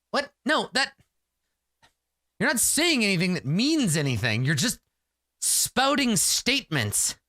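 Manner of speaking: high, frustrated protest, slowing on "spouting statements"; exasperated warrior. Acted. Warrior exasperated at the hero's glib answers. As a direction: call out their empty words.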